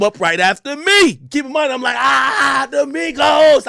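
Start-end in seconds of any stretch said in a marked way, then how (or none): 2.0-3.7 high, stretched fanboy cheer; mock squeal of delight; excited fan. Host re-enacting meeting the Migos, excited cheering. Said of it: "I'm excited".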